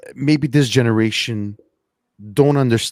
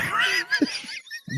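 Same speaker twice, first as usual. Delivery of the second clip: breathy laugh; 7 dB quieter than usual. Real, not acted. Laughing at own story.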